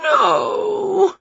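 high, drawn-out wail. Acted. Witch refusing the straw, high wail. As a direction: wail it like a child. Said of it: "wailed Winnie".

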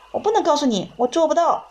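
high-pitched distressed protest; distressed refusal. A narrator's reading. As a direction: plead helplessly, voice jumping up high. Patient refuses, distressed, under questioning. (痛苦无助地尖声抗拒)

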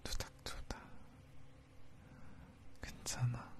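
hushed lulling whisper; soothing whisper. Acted. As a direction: whisper her to sleep. Lulling her to sleep.

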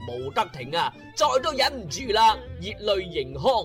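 ordinary delivery

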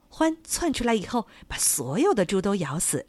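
usual speaking voice

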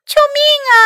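very high, loud cries; yelling for help. A narrator's reading. Mac can't stop and begs for rescue, yelling. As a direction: scream for rescue in alarm. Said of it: "他大喊道".

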